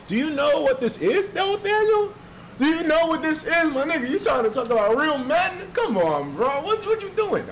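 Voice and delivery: high-pitched, incredulous rant; indignant, voice shooting way up; mock outrage. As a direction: squeal your disbelief at being challenged.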